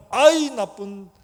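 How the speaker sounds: hammed-up parental telling-off; acted scolding. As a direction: scold a daughter in exasperation, playing it big. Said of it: "이러면서 혼내야 되는 게 정상입니다".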